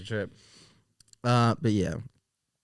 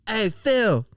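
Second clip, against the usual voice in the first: high-pitched mock holler across a bar; calling out; 11 semitones higher than usual. Uncle teasing his friend in front of everybody, calling out. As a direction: imitate an uncle loudly ribbing his buddy. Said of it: "just out loud in front of everybody".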